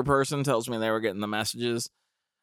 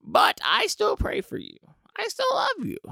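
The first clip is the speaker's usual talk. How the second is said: put-on high-pitched, syrupy old lady; climbing up high on the prayer line; sweet old neighbor; 6 semitones higher, 5 dB louder than usual. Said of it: "she's basically like".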